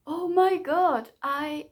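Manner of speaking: overwhelmed by the ending, let it spill out; emotional gush. Real, not acted. Gushing about crying at ending.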